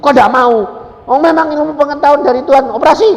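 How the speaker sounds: high, loud, quick rebuttal; loud exclaimed objection. Retold or imitated. Preacher arguing with woman afraid of operation, loud objection. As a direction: argue it at a high shout. Argues with the frightened patient.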